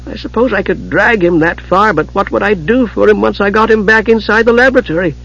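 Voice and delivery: high-pitched kender thinking aloud; puzzled, worried kender. A narrator's reading. Tass wondering how to save Palin, uncertain.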